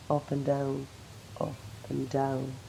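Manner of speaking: low, soft and slowing repetition; subdued pacing. Acted. Maggie walking the river alone, subdued.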